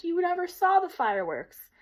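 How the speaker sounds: mock her little sister's claim; scornful big sister retort; scoffing. A narrator's reading. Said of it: "Anne-Marie scoffed".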